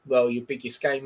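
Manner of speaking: ordinary talk, nothing marked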